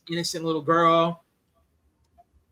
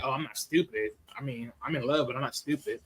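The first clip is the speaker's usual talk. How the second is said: knowing low reply; 7 semitones lower, 9 dB quieter than usual. Retold or imitated. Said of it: "I'm like".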